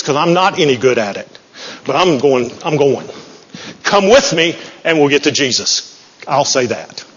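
usual speaking voice

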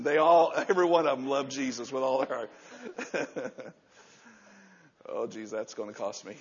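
ordinary delivery

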